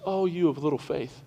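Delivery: lightly voiced gentle Jesus chiding the disciples; Jesus gently rebuking. Jesus to the frightened disciples, mild soft rebuke. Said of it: "Jesus gets up and goes".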